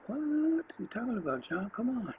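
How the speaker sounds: as if someone just asked something absurd; incredulous disbelief. Retold or imitated. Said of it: "And I'm sitting there going".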